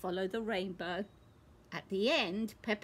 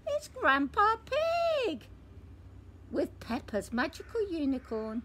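much higher, louder, stretched reveal; surprised announcement; 6 semitones higher, 3 dB louder than usual. Acted. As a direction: unveil the guest like a big surprise. Surprise arrival at door.

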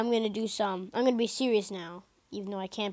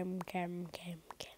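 whisper; 6 semitones lower, 19 dB quieter than usual. Real, not acted. Calming himself down.

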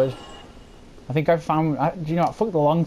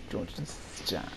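whisper; 8 semitones lower, 15 dB quieter than usual. Pretend. Repeats line as a whisper.

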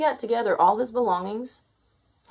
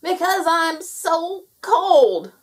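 loud, high, drawn-out wail; shouted; 10 semitones higher, 7 dB louder, much slower than usual. A narrator's reading. Gnome answering the otter, shouting from cold. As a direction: shout slowly, stretching the misery. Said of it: "shouted the gnome".

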